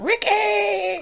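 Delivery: very high, long screamed name; EastEnders scream. Retold or imitated. Imitating EastEnders woman calling out, high mock scream. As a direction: wail the name like a soap-opera row. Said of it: "that ginger one in EastEnders".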